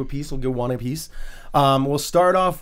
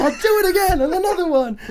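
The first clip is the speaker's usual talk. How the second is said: Frank giving in. Retold or imitated. Frank finally agreeing to drink, high and gleeful. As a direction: cave in with a gleeful yes. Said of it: "And he's like".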